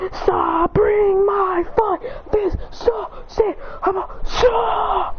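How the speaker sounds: high, strained cries of effort; straining yell. Acted. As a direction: push against the beam while yelling. Straining in a beam clash.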